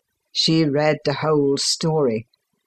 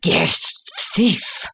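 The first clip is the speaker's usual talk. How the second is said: angry retort, pitch jumping on the last word; narrated roar, kept fairly contained; roared accusation; 4 semitones higher than usual. A narrator's reading. Father shaking his son by the shoulder, roared accusation. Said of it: "I roared".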